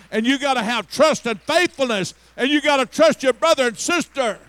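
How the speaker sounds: loud, rising preaching as God speaking. Acted. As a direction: call it out like God urging the flock on. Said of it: "God wants to turn the light on and say".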